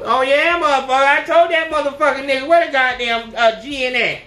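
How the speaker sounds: high, loud, fast cussing rant; yelling about her man at home; mock loud country girl. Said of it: "Don't be no".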